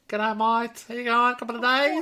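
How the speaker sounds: rising, put-on ocker Australian; caricature Aussie accent. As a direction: ham up a broad Aussie g'day. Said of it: "didn't sound very Australian at all".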